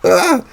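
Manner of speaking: sharp, high, loud startled cry; startled yelp. Acted. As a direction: jump at a sudden noise. Startled by another honk.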